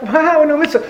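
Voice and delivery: high-pitched delighted wonder; excited farmer. Acted. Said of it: "The farmer got all excited".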